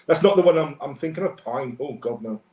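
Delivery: usual speaking voice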